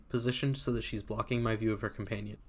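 plain reading voice

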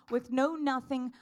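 ordinary delivery

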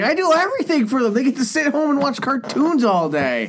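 high, hurried mock protest; indignant kid's reasoning. Speaking as the kid defending himself, in high, mock-aggrieved protest. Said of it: "His idea, he's like".

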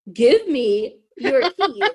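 slow, insistent, each word weighted. Retold or imitated. Insisting he hand over his car keys, slow and firm. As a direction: lay down the law on the second ask.

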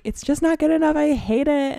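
mock despair over my work; imitating my own frustration; self-critical whine. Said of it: "I was like".

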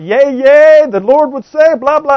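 a loud, lifted parody of a pompous prophet; mild mock oracle voice. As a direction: lightly ham up a pompous prophet. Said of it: "ever heard the prophet".

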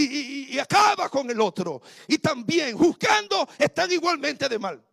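loud, driving sermon voice; preaching at full force. Acted. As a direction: drive the point home hard. Preaching against gossip.

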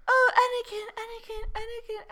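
high, swooning sigh of a name; swooning Padme. Retold or imitated. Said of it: "And then she's just like".

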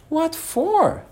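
high-pitched query; surprised question. A narrator's reading. Alice asks about the sentence, surprised.

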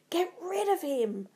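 high, strained outcry; screeching. A narrator's reading. Macbeth recoiling from the ghost, screeching. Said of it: "Macbeth screeched".

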